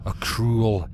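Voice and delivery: ordinary delivery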